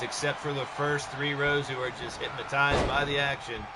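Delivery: ordinary talk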